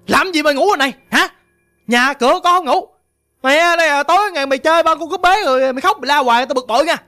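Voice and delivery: acted scolding. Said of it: "ổng chửi chị không".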